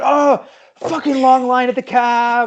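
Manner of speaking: high, slow, fed-up grumble; irritated New Yorker. Retold or imitated. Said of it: "It's like".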